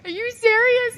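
high, overwhelmed, half-sobbing thanks; tearful gratitude. Retold or imitated. Wrestler thanking the Rock after his movie news, tearfully high. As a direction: squeak it out through happy tears. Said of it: "I started bawling my eyes out".

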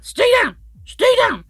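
high, loud, fierce order; growling command. Acted. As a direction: bark it at your stomach. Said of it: "scaring them into staying down".

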